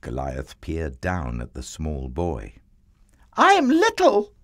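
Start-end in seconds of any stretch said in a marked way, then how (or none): 3.3-4.3 put-on very high, loud boy's shout; David shouting up. A narrator's reading. Small boy shouts up to the giant, high defiant shout. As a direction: call up boldly to someone far above. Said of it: "David shouted up to him".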